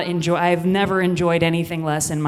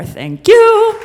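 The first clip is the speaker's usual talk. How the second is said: loud sign-off; 14 semitones higher, 11 dB louder than usual. Real, not acted. Comedian signs off to audience.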